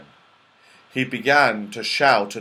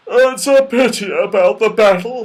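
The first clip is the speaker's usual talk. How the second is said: raised, loud, lamenting bear voice; mournful bear; 9 semitones higher, 9 dB louder than usual. A narrator's reading. Father Bear regrets the snowball battle, mournful. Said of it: "said the Father Bear mournfully".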